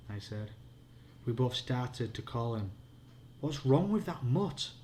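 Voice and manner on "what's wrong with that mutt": crying out. A narrator's reading. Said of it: "I cried".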